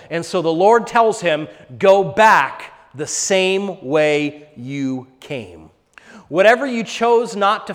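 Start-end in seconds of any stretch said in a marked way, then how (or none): 1.8-5.7 slow command. Retold or imitated. God's command quoted.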